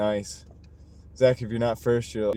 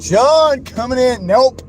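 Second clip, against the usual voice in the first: slow, much louder and higher hype shout; excited yell; 11 semitones higher, 9 dB louder, slower than usual. Real, not acted. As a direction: belt it like a ring announcer, thrilled. Hyping rival donation.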